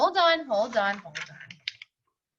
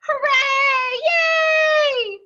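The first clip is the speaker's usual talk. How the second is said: very high, loud joyful cheer; cheering kids; 14 semitones higher, 9 dB louder, much slower than usual. Acted. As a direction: cheer like a whole class of kids. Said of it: "shouted the boys and girls".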